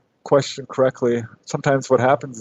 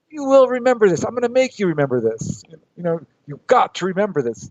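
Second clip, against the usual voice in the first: much higher, pleading insistence; insistent plea; 6 semitones higher than usual. Retold or imitated. Arguing with brother while out of body, insistent and higher. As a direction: insist he must remember.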